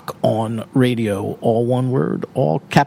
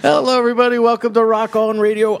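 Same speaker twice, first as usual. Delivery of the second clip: radio announcer; 8 semitones higher than usual. Acted. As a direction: announce it like opening the show. Radio host opening the show.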